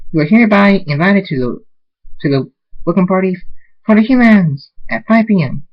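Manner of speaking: high-pitched, eager announcement; excited invitation. Acted. Party pony inviting guests to welcome party, excited.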